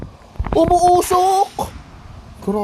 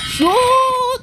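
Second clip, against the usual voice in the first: launch shout; 11 semitones higher than usual. Real, not acted. Launching Beyblade, calling out.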